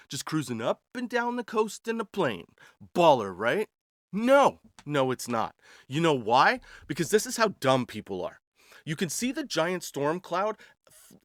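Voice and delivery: ordinary talk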